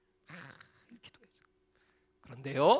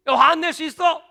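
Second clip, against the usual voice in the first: higher, louder mock telling-off; exasperated scolding; 7 semitones higher, 8 dB louder than usual. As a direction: scold a pestering caller. Pastor retorts angrily.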